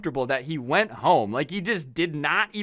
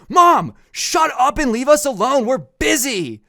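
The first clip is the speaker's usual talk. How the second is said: loud, high, snotty shout at his mother; rude yell at mom; 10 semitones higher, 8 dB louder than usual. Retold or imitated. Teen yelling at his mom to leave, bratty shout. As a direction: bark it at her like a bratty teen. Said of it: "can't believe he talked to his mom like that".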